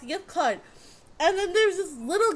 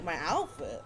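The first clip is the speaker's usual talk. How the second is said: much lower, softer puzzled echo; mild mock surprise; surprised; 10 semitones lower, 9 dB quieter than usual. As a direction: ask it in flat disbelief. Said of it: "I was just like".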